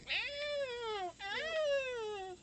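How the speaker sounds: very high, sustained animal whine; imitated whining. Imitating Starbucks whining outside the door, high-pitched whine. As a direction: mimic a thin, drawn-out whine, softly. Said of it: "standing out there going".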